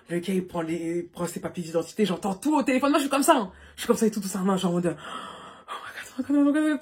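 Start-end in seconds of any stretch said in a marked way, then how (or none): 4.9-6.3 breathless, slow, whispered oh my god; panicked whisper. Retold or imitated. Panicking on the phone. 6.3-6.8 panic. Retold or imitated. Panicking on the phone.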